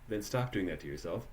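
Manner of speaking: normal talking voice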